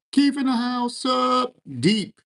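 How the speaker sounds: very high, drawn-out hail; whooping greeting. Real, not acted. Streamer announcing Keith in the house, whooping greeting. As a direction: call out his arrival way up high. Hails viewer Keith in chat.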